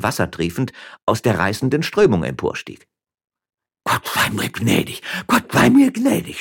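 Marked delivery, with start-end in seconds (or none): 3.8-6.4 panicked plea, rising to a high cry; distressed, high, spluttering old man; stammering old man. A narrator's reading. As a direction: gasp out a prayer, half-drowned. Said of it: "stammelte der schändliche Alte".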